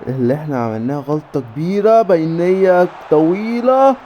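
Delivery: ordinary talk, nothing marked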